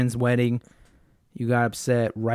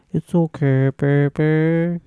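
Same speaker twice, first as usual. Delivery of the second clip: mock soothing; 4 semitones higher, 4 dB louder, much slower than usual. Mock comforting his sad girlfriend.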